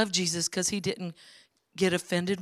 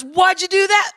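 suspicious demand; 12 semitones higher, 11 dB louder than usual. Retold or imitated. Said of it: "And he goes".

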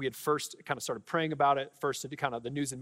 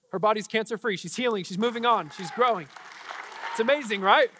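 higher, louder, fervent preaching; preaching at full force; 6 semitones higher, 5 dB louder than usual. Acted. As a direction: build to a proclaiming peak. Proclaims cancer-free news.

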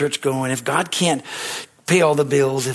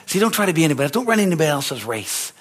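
ordinary talk, nothing marked

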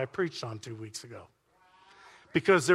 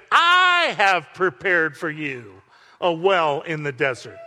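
slow, louder proclamation; angel proclaiming; 7 dB louder, slower than usual. Acted. Angel of the Lord promising Hagar a well, booming slowly. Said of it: "the angel of the Lord came and said".

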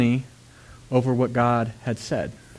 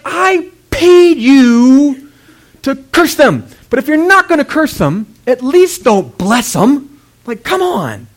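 loud, high, stretched-out indignation; hammed-up outraged complaint, leaning hard on words; exasperated king; 12 semitones higher, 10 dB louder, slower than usual. King berates the prophet he paid, exasperated.

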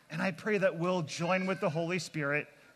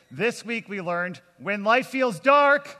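loud recap shout; 7 semitones higher, 3 dB louder than usual. Real, not acted. Pastor leads the kids in a loud shouted recap. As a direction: belt it out so the whole room joins in. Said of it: "I need your help one more time".

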